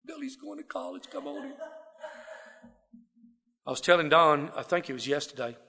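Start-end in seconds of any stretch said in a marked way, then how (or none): 0.0-1.5 high, soft, beaming father's voice; a father showing off his son, pitched high and hushed; proud daddy. Retold or imitated. Father greets son home from service, high and soft. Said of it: "my daddy greeted me at the car".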